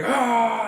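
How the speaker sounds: enraged roar. Acted. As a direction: roar in rage at your attacker. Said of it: "he's raging at you".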